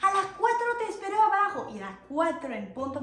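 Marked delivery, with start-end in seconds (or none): 0.0-1.7 higher, louder firm order; dad's instruction. Retold or imitated. Dad telling her when to be downstairs, higher firm voice. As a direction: set the time firmly. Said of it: "Mi papá me dice".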